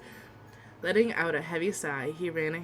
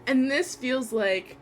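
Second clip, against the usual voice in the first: emotional gushing; 6 semitones higher, 5 dB louder, slower than usual. Real, not acted. Emotional gushing about first crush.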